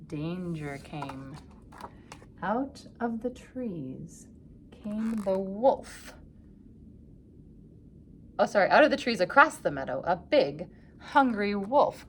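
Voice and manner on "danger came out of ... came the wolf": very slow, hushed build; suspenseful. A narrator's reading. Narrator announcing the wolf, slow and hushed. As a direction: draw it out with dread.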